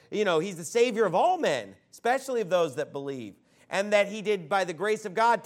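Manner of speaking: ordinary talk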